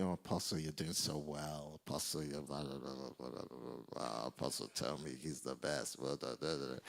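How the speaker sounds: low, soft mumbled gushing; mock flattery. The world telling the apostle he's doing well, mock low murmur. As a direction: drone the praise down into blahs. Mimics people flattering him.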